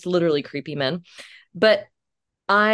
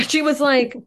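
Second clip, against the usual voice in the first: usual speaking voice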